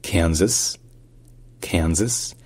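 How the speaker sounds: Kansas said "KAN-zəs"; American English pronunciation. Shown as a demonstration. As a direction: stress the first syllable of Kansas, z in the middle. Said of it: "ça se dit".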